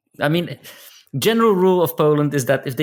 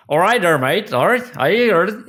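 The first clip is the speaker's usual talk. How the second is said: put-on Cornish pirate accent; 5 semitones higher than usual. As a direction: play a cheery Cornish local like a pirate. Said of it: "talking to you like a pirate".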